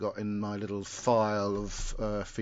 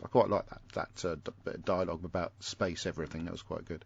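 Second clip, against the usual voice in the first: normal talking voice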